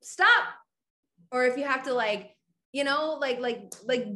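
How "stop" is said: sharp, high, loud command; yelled stop. Shown as a demonstration. Yelling at your own negative thought, loud sharp command. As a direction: bark it to cut the thought off. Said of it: "if you gotta yell out loud to be like".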